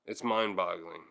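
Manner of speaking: usual speaking voice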